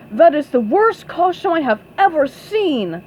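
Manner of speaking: loud, lightly booming scorn; emphatic put-down with strong stresses; Flying Dutchman. A narrator's reading. Flying Dutchman scorns SpongeBob's costume, mildly booming. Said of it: "said the Flying Dutchman".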